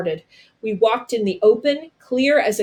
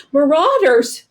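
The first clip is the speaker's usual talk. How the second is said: high, loud warning cry; alarmed shout; 7 semitones higher, 7 dB louder, slower than usual. Acted. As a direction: yell the alarm as raiders come.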